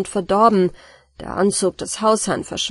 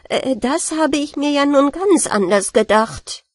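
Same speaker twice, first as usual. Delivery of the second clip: bigger alarm this time, pitched up; dismayed surprise, higher and louder; 6 semitones higher, 4 dB louder than usual. Acted. Rat, flooded cellar, louder dismayed cry.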